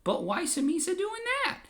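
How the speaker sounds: high puzzled Gungan voice; Jar Jar. Acted. Jar Jar questioning Palpatine, high puzzled voice. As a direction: ask it high and innocent. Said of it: "And Jar Jar says".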